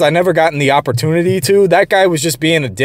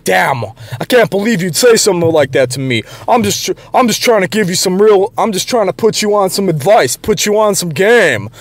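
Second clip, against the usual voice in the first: higher-pitched imitation of a protesting coworker; mock backing down; 5 semitones higher than usual. Coworker protesting he was just giving advice, mock backing down. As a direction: mimic someone backpedalling in a raised voice. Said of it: "he backed off asap".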